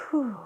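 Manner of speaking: long, soft, airy sigh; deep exhale. Real, not acted. Tarot reader taking a breath before the reading, deep exhale. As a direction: breathe out slowly and audibly. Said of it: "take a breath".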